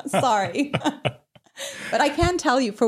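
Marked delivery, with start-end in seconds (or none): 0.0-0.8 sorry said through laughter; giggling apology; laughing. Real, not acted. Laughs at her own joke.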